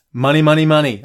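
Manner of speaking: normal talking voice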